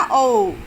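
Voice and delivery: ओ held long and loud; loud, sustained demonstration of ओ; prolonged vowel. Shown as a demonstration. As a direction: stretch ओ over three beats. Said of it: "तीन मात्राओं का समय लगता है".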